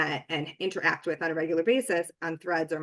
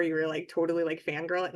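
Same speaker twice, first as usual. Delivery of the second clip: ordinary delivery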